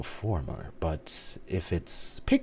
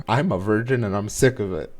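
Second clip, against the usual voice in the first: loud, high, fed-up outburst; mock-exasperated proclamation; mock declaration; 6 semitones higher, 11 dB louder than usual. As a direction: announce it loudly, as a joking paraphrase. Mock blunt declaration for character.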